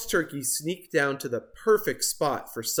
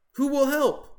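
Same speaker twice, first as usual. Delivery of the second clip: Turkey voice; 12 semitones higher, 3 dB louder than usual. Acted. Turkey voiced, high put-on voice.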